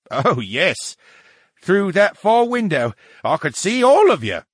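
high, loud, knowing brain; smug talking brain. Acted. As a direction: declare it with relish in a put-on voice.

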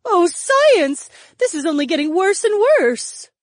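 high, loud wail of dismay; distressed cry. Acted. Daisy watching things get worse, crying out. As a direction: exclaim it in rising distress. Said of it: "Daisy cried".

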